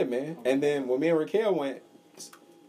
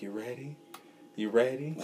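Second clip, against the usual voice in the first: whisper; 7 dB quieter than usual. Retold or imitated. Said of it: "The lady just kept saying".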